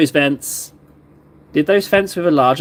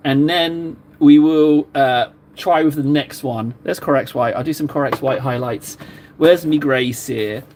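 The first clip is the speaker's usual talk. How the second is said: normal talking voice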